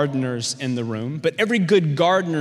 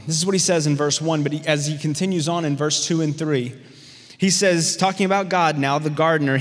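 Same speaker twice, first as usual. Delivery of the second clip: usual speaking voice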